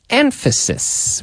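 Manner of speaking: Emphasis said high and slowly drawn out; high, slow model take. Shown as a demonstration. Teacher modelling the word for students, high and slow. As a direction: lift Emphasis high and stretch it. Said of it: "We say emphasis".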